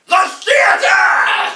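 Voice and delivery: huge, high, drawn-out shout; horrified outburst. Acted. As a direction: bellow it slowly in melodramatic horror. Doctor's horrified climax in play.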